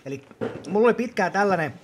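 usual speaking voice

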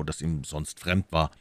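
ordinary delivery, nothing marked

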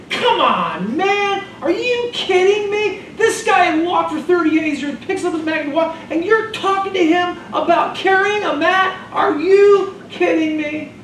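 high, laughing are-you-kidding-me outrage; incredulous disbelief. Acted. Pastor acting a bystander scolding the leaders, high incredulous laugh. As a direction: throw up your hands in amused disbelief.

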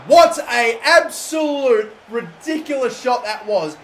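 loud and drawn out in disbelief; stunned by a wild shot, slow and loud; amazed shout. Real, not acted. Near miss by the opponent.

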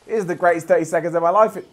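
ordinary delivery

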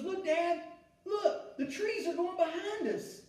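a grown man playing an amazed young son; excited little boy. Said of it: "and he goes".

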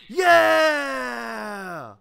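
loud, high victory yell; triumphant shout. Real, not acted. Sudden triumphant yell in game.

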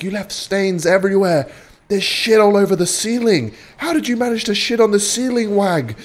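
high-pitched mock mom voice; scolding mother. Mother tells off son over the toilet, scolding. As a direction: play a mother appalled at her son's mess. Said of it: "His mother's like".

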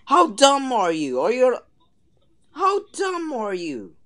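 taunting. Real, not acted. Taunting enemy dinosaur.

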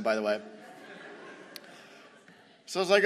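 ordinary delivery

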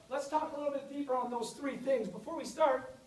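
ordinary talk

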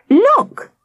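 high, loud excited cry; shouted. Acted. Sam calling the others about his find, excited shout. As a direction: call everyone over, thrilled. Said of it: "shouted Sam".

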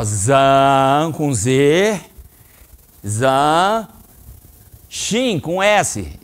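very slow "Zan" then "Shin" with a clean S sound; spelling out Zanshin. Shown as a demonstration. Sensei spelling Zanshin for the students, very slowly. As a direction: spell it out syllable by syllable. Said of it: "o S em japonês tem som de S".

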